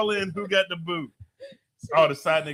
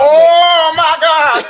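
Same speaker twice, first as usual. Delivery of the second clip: loud, high, drawn-out scream; shocked outcry; 13 semitones higher, 15 dB louder, much slower than usual. Real, not acted. Host reacting to a guest's scandalous answer, shocked shriek. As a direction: shriek it out, stretching the words.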